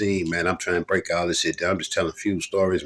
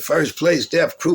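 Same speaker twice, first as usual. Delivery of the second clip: high, louder winner announcement; announcer call; 9 semitones higher, 4 dB louder, slower than usual. Retold or imitated. Host calling out the winners, loud and high. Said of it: "they said".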